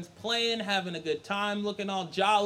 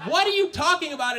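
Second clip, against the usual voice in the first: high, quick disbelief; baffled protest; 7 semitones higher, 4 dB louder, faster than usual. Acted. Son answering the demon claim, baffled protest. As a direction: blurt it out incredulously.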